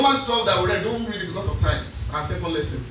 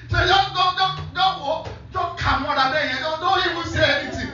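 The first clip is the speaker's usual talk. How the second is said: mock scolding; 8 semitones higher than usual. Retold scolding of the colleague.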